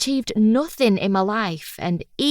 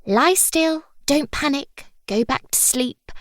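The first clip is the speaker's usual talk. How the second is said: high-pitched mimicked nurse patter; nurse's running commentary; 6 semitones higher than usual. Patient mimics the woman from intensive care, high nurse patter. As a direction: mimic stock nursing reassurances, voice lifted. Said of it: "the one doing the running commentary".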